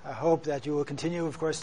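ordinary delivery

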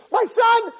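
very high, loud parental cry; angry outburst. Acted. Parent starting to yell at a son, angry outburst. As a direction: burst out as if about to scream. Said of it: "The minute you say".

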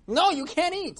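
high, loud forbidding shout; upset. Acted. Crowd forbids old man to eat, upset shout. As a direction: yell it in outrage. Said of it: "they were all upset".

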